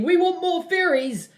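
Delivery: whiny high mock fans, slow; mock commenters. Commenters begging for theories, mock whiny. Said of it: "all you people commenting".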